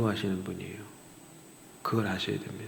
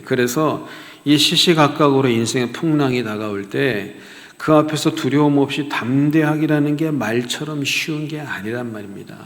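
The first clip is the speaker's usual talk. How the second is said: ordinary delivery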